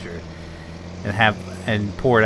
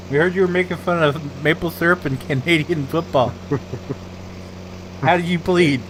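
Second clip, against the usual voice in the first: higher, polite Canadian voice through laughs; mock Mountie; 4 semitones higher than usual. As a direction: charge him sweetly like a Mountie. Mountie arresting an American.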